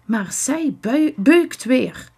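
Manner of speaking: hoog, verontwaardigd sissen; sissend. A narrator's reading. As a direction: sis je klacht over haar. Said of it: "sist Stef".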